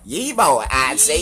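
high country holler; exaggerated country voice. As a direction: holler high like a hammed-up Alabamian. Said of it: "I can sound as country as I fucking wanna".

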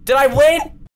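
high, loud disbelieving what; can't believe it ended; incredulous surprise. Real, not acted. Surprise at game ending.

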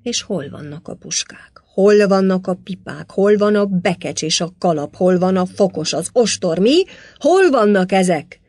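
loud, hammered demands; angry bellowing. A narrator's reading. Lord demanding his missing things, bellowing angrily. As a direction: fire off furious questions, building in force. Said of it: "ordított a főispán".

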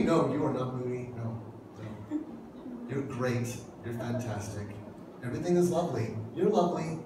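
soft, hushed, placating husband; soothing reassurance. Retold or imitated. Husband soothing his wife who asked if she's moody, softly. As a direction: tiptoe around her, keeping it quiet and gentle.

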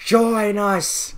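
high, drawn-out invitation; mock-glorious recruiting call; revolutionary comrade. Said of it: "He goes like".

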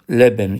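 Lebenün, said without the el prefix; bare Arabic form without the article. Shown as a demonstration. Teacher showing students the word without el, clear demo. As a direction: drop the article, just Lebenün. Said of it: "el takısını attım".

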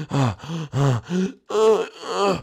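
thanking Junior after the escape; a hint of relieved friend.

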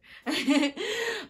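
a high, loud burst of laughter; real laugh. Real, not acted. Vlogger laughs at her pun to the viewers. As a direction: crack up at your own joke.

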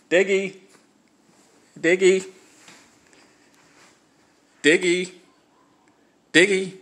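high, loud call across the yard; calling from far away. Real, not acted. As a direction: shout the dog's name from a distance. Calling the dog from outside.

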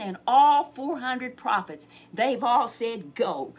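slow and loud on all four hundred; emphatic, slow. Acted. Messenger pressing Micaiah, slow and emphatic. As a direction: press each word home. Messenger stressing prophets' agreement.

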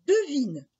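high, loud teasing triumph; triumphant boy. Acted. Jacques makes his mother guess the price, triumphant. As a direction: toss it out triumphantly. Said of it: "avec un air triomphant".

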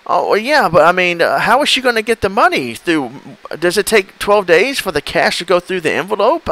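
a hint of a clueless caller; naive victim voice. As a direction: play dumb, sweetly confused. Baiter plays innocent victim.

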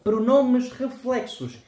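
slow, high, careful Portuguese; Portuguese title. Shown as a demonstration. Teacher announcing the topic, in slow careful Portuguese.

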